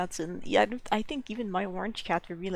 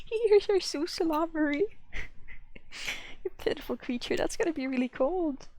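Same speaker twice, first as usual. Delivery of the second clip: sing-song pet voice, pitched up; pet talk; 8 semitones higher, 4 dB louder than usual. Real, not acted. Cooing at her wet cat.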